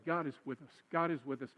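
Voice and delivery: soft, low, sincere reassurance; kindly, without sarcasm. Shown as a demonstration. Pastor showing how to comfort someone whining, gentle and sincere. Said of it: "Don't say it meanly. Don't say it sarcastically".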